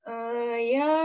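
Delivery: drawn-out thinking hums and ums; hesitant filler sounds. Shown as a demonstration. Teacher imitates a speaker hesitating with fillers, mock hesitant. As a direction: stall like someone searching for words. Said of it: "Kelihatan banget pemikir gitu ya".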